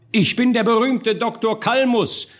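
high, grand self-announcement; solemn proclamation. A narrator's reading. Orator introduces himself to the burghers, solemnly proclaiming. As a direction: proclaim your title solemnly to the crowd. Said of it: "sprach der Redner mit feierlicher Stimme".

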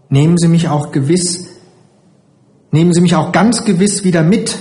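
leicht angedeutete Kinderstimme, die bittet; ängstlich bittendes Kind. A narrator's reading. Anxious child begging, voiced by narrator. (ängstlich flehend, etwas höher gesprochen)

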